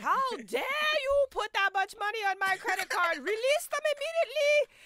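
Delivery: high, indignant mock scolding; imitated outrage. Imitating Susie's mom scolding over ticket charges, outraged. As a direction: build to a high mock demand.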